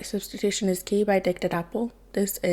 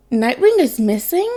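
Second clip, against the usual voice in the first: demanding; 5 semitones higher, 5 dB louder than usual. Acted. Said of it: "Wally West demanded".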